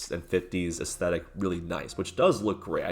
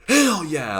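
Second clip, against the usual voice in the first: a much louder and higher whoop of victory; mock triumphant shout; 10 semitones higher, 10 dB louder than usual. A reviewer imitating [name]'s loud, triumphant whoop. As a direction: yell it like your team just scored. [name] cheering a win.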